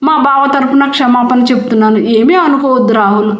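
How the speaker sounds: ordinary delivery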